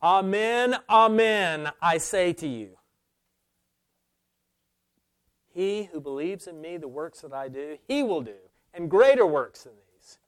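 "Amen, amen" proclaimed loud and high, each word held; ringing scripture quote with "amen" and "greater" stressed hard; emphatic promise. Acted. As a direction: declare it forcefully, punching "he" and "greater works". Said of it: "how emphatically Jesus has promised this".

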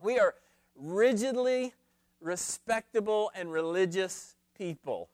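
how dare you question us; indignant challenge. Retold or imitated. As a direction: Pharisees snapping back at Jesus, indignant. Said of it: "not "Who are you?" but".

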